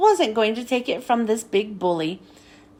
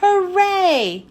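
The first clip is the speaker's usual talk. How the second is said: shouting; 5 semitones higher than usual. Acted.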